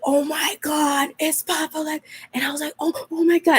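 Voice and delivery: stunned disbelief at the figure by the sign; seeing the spirit in the rear-view mirror, awed; awestruck gasp. Retold or imitated.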